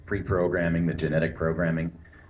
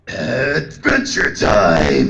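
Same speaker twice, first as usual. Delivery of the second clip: slow, loud gruff snarling growl; Wolverine voice; 4 semitones higher, 10 dB louder, much slower than usual. Shown as a demonstration. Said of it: "say Adventure Time in your Wolverine voice".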